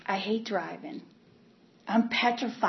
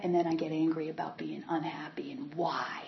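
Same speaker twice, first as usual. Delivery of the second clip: tearful whisper; 4 semitones lower, 8 dB quieter than usual. Real, not acted. Confessing anger at unhappiness.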